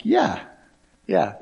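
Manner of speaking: first yeah jumps up high then falls; high amused yeah. Retold or imitated. Laughing agreement retold.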